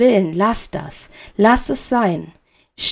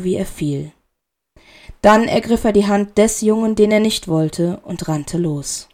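ordinary delivery

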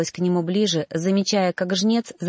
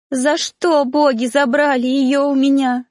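high, louder wail of a bereaved mother; anguished cry; 6 semitones higher, 5 dB louder than usual. A narrator's reading. Mother who lost her child cries to the gods, anguished. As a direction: cry out in grief at the loss. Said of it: "кричала мать".

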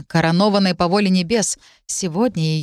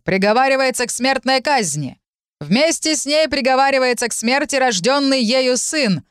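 town crier; 5 semitones higher than usual. A narrator's reading. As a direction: proclaim it to the whole square. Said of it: "Громкий голос глашатая".

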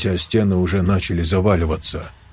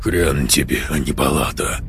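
rasped; 8 semitones lower than usual. A narrator's reading. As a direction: croak it out low. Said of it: "прохрипел". (хрипло)

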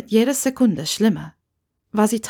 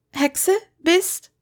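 higher, choking the word out; forced out; 9 semitones higher than usual. A narrator's reading. Hermine forces out the word witch, choking on it. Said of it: "sie würgte am Ende ihres Satzes".

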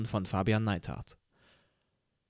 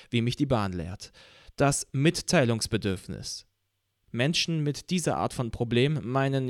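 ordinary delivery

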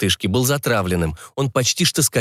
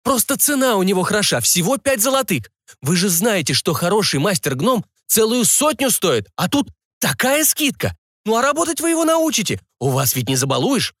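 high-pitched, anxious sales patter; flustered barker; 10 semitones higher than usual. Acted. Barker fussing over an unhappy rich client, flustered coaxing. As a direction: plead the bargain in a raised pitch. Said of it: "засуетился зазывало".

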